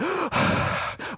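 sharp high breathless gasp for air; desperate gasp on surfacing. Acted. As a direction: gulp air as if just out of the water. Surfacing after being held underwater.

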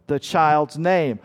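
ordinary talk, nothing marked

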